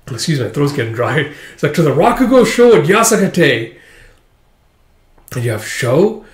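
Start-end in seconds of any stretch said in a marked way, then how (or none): 1.7-3.8 higher, louder showman's announcement; boisterous master. Acted. Master invites kids to the Rakugo show, boisterous. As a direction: announce it with hammy gusto.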